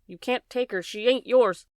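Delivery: screaming. A narrator's reading. Bryce screaming at the father not to take her: high loud scream. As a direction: yell it, pleading and furious. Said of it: "Bryce screamed".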